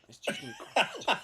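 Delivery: high-pitched mock boast; Devon Moreno. Shown as a demonstration. Host imitating an acquaintance marvelling at himself, high mock American voice. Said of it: "My American accent is Devon Moreno".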